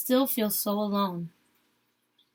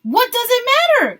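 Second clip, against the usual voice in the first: high, loud furious cry; yelled; 13 semitones higher, 7 dB louder than usual. A narrator's reading. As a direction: throw it back at him. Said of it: "yelled Marta".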